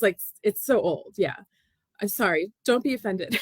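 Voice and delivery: ordinary delivery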